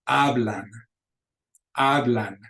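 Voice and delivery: hablan with silent h and stressed HA-; stress on second-to-last syllable. Shown as a demonstration. Teacher showing the accent rule, hablan stressed on the first syllable. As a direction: hit the first syllable of hablan, let it fall. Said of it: "second to the last syllable".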